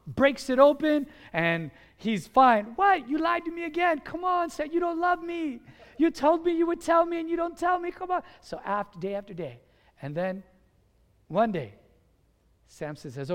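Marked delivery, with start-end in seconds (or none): 2.8-8.2 high-pitched lover's complaint; whiny nagging. Acted. Delilah complains that Samson doesn't love her, whiny nagging. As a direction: pester him relentlessly, pitched high.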